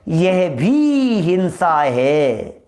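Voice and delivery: भी stretched out very long; slow, drawn-out pronouncement, leaning hard on भी; emphatic verdict. Acted. Climax of the scriptural argument.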